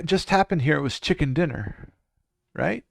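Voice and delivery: usual speaking voice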